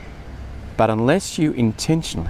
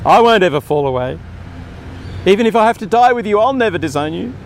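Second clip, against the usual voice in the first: louder and higher, faster vow; 6 semitones higher, 3 dB louder, faster than usual. Retold or imitated. Preacher replays Peter's boast to Jesus, louder and higher. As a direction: blurt the vow out, sure of himself. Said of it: "Good intentions".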